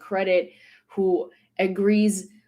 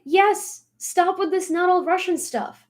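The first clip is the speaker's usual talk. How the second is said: quoting friend, raised; 8 semitones higher than usual. Retold or imitated. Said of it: "He's like".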